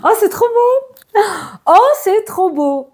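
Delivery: high, slow gushing delight; thrilled. Real, not acted. Reacting to her viewer's lucky card, high and thrilled. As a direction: swoon over how good it sounds.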